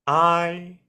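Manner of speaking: very slow, deep sleepy drawl; super slow sleepy sloth. Retold or imitated. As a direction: drag every word out like the Zootopia sloth. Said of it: "I just imagine him speaking like the, the D M V sloth from Zootopia".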